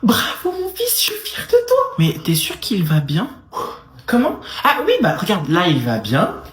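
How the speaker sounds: loud, excited mother yelling across; a mother hollering, thrilled and worried; proud shouting mother. Acted. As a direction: shout your pride at your son.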